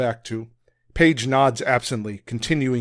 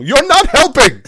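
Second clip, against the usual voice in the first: very loud, high furious yell; 12 semitones higher, 10 dB louder than usual. Acted. Strom unleashes his fury at Will, screaming. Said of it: "unleashes his fury at Will".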